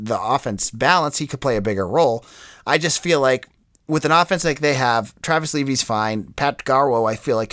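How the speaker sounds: normal talking voice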